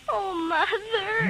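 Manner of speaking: slow, tearful cry. Acted. Daughter reaching out to her mother, slow and crying. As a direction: draw it out through tears.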